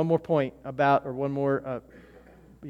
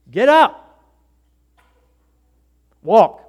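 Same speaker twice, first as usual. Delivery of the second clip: loud, high command to rise; commanding call; 6 semitones higher, 13 dB louder, slower than usual. Retold or imitated. Jesus ordering the paralyzed man to get up, loud and high. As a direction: order him up with full force. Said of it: "he goes".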